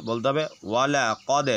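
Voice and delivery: usual speaking voice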